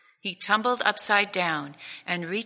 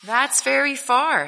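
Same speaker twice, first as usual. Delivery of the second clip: higher and louder, wide-eyed little bunny; little hare amazed; 7 semitones higher, 6 dB louder than usual. Acted. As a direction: let the little hare marvel at the distance. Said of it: "thought Little Nut Brown Hare".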